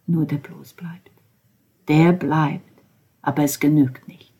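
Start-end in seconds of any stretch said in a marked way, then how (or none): 0.1-1.1 whisper. Acted. Whispered resignation.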